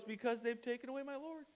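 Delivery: tiny, high, very soft tearful voice. Acted. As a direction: play her as a small, grieving voice. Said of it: "she's still crying".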